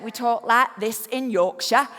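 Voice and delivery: low-pitched northern English Yorkshire accent. Shown as a demonstration. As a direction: slip into broad Yorkshire speech. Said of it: "that's more what people sound like from where I come from".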